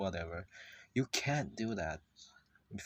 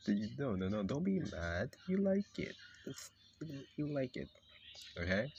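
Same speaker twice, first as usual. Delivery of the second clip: coaxing insistence. Acted. Placating the mad character.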